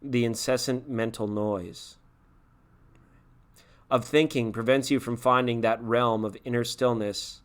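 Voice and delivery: slow, measured reading of the passage; calm, unhurried spiritual reading. Shown as a demonstration. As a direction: read it slowly, letting each phrase settle. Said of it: "He might read it again like this".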